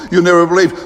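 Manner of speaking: excited disciple blurting news. Acted.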